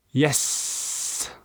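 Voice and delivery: hissed yes with a long s; drawn-out s like a snake. Shown as a demonstration. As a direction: whisper it and stretch the s like a snake. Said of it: "I sounded like a snake".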